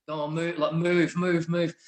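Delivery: re-enacting shoving past people; retold urgent command. Shoving through a crowd, retold.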